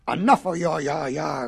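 low, growling and gruff; angry growl. A narrator's reading. Big bad wolf silences the taunting pigs, low growl. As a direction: growl it in fury. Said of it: "growled the big bad wolf".

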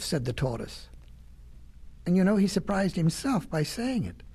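plain reading voice

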